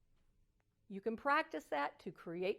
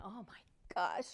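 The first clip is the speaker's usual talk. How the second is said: slow stunned whisper; whispered oh my gosh; 3 semitones lower, slower than usual. Retold or imitated. Grandfather whispering after his wife catches him. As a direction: breathe it out, caught out. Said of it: "I just went".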